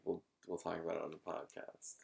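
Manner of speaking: quiet shushing tone, retold; hushed quote. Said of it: "you were shushing me".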